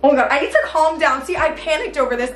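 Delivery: ordinary talk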